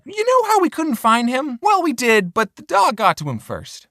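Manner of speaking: high, put-on mom voice; mom's voice, matter-of-fact; imitating mom. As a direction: play the mother breaking the news lightly. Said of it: "She said".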